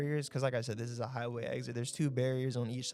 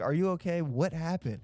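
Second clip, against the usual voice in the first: concerned asking; 4 semitones higher than usual. Retold or imitated. Checking on other driver.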